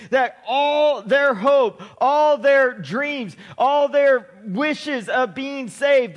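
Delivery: slow, drawn-out preaching cadence; preaching at full force. Acted. Preacher stirring the congregation, slow and forceful. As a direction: hammer each phrase home.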